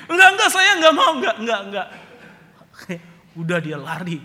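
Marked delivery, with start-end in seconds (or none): 0.1-1.9 fast, high, loud through laughter; panicked laughing denial. Retold or imitated. Scared father laughs off the threat and runs. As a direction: babble it out while fleeing.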